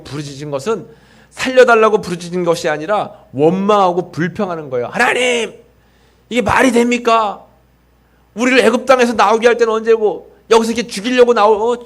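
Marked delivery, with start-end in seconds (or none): 4.9-11.8 higher, a touch of a griping protest; grumbling complaint. Retold or imitated. Pastor acts out Israelites grumbling at God, mock complaint. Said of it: "하면서 원망하는 겁니다".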